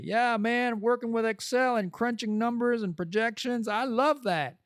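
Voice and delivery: high-pitched gushing about numbers; mock enthusiasm. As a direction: gush like a thrilled number nerd. Hypothetical excited self-quote.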